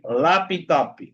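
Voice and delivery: laptop as "lapi-topi"; added final I. Shown as a demonstration. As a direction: say laptop Brazilian-style, an i after each p. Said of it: "agregarle una I a la sílaba".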